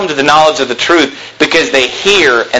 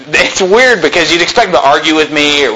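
normal talking voice

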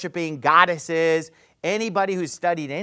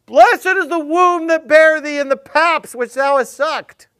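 higher, louder, slow call from the crowd; lightly voiced woman crying out from a crowd; 9 semitones higher, 10 dB louder than usual. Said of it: "This woman yells out in the crowd".

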